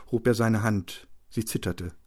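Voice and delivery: ordinary delivery, nothing marked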